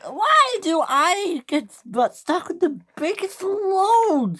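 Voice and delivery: high, drawn-out whine; whiny wail. A narrator's reading. Millie complaining about the heavy load, whiny wail. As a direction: wail it slowly, dragging out the words.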